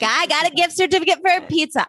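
high, quick mimicking voice; mock a friend showing off a gift; imitated excited announcement. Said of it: "She's like".

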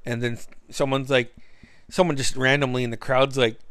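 usual speaking voice

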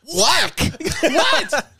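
high startled what; a sudden, shocked double take; stunned what. Real, not acted. Reacts to fake voice message.

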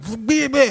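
high, babbled mock retort; mocking bleh noises. Heckler giving up and sitting down, mocking babble.

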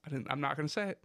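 ordinary delivery

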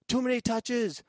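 high, loud imitation of a nagging coach; imitated sideline shout; mock coach yell. Acting out over-controlling coaches.

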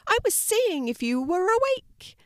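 high, loud little rabbit voice; gentle rabbit calling out to a scared puppy; bright high rabbit, loud. A narrator's reading.

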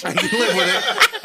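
giggled out, high; laughing. Real, not acted. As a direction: say it while cracking up. Repeats the line, laughing.

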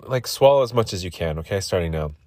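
ordinary talk

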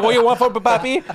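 high, loud mock steward voice, laughing; playful steward. Mimics the Filipino steward's greeting.

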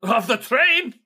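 amused NPC retort; Yevgeny's voice. Acted. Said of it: "GM voicing Yevgeny".

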